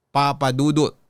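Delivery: normal talking voice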